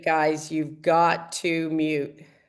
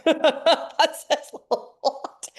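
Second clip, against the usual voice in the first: much higher, slow, laughing repeat; 10 semitones higher, much slower than usual. Real, not acted. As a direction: let the laugh carry the words. Repeats remark, then laughs.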